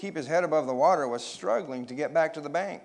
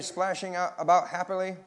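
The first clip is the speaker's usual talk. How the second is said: ordinary delivery, nothing marked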